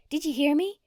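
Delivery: high, loud demand at her husband; wife snapping at her husband, loud and high; berating. Acted. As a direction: bark it at him, high and loud. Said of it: "She berated poor old Darnell".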